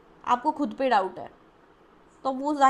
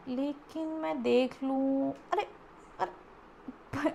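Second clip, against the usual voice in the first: slow, drawn-out venture, then startled breathy murmur; acted tentative peek; 6 dB quieter, much slower than usual. Reader mimics client daring to look, acted tentative peek. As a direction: imitate creeping forward and shrinking back. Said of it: "ऐसे ये कर रहे हो".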